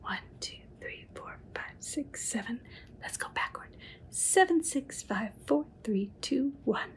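soft breathy count under the breath; whispered counting. Acted. Reading to a child, counting apples in a whisper. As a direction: count quietly like a secret.

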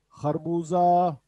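harbuza drawn out with a throaty h; slow model word. Shown as a demonstration. Teacher modelling harbuza slowly for students. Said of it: "teacher slow model".

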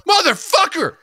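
high, full-volume mock shout; hammed-up furious bellow, stretched out; screaming obscenities. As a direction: yell it like an exploding parent. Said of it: "I just scream obscenities at her".

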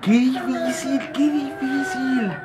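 high, slow, soft lament; defeated whine. Real, not acted. Player lamenting how hard it is, soft high whine. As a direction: whine it out slowly, beaten.

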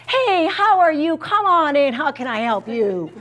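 high, loud overly cheerful greeting; imitating cheery colleague. Counselor mimicking her upbeat coworker greeting students, high and loud. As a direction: beam it like a too-happy colleague. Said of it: "Mister Smith is like this".

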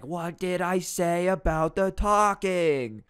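high, loud, slow menacing librarian; stern scolding. Retold or imitated. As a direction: threaten the whole class slowly. Librarian slams hands, scolds class.

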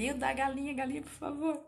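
high, mock-pleading ask for the hen; pleading viewer. Imitating a viewer begging her to show the hen. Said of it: "você me fala assim".

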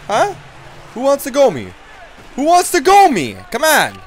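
taunting shout. Pretend. Enforcer calling out the other team, taunting shout. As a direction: yell it at the whole bench.

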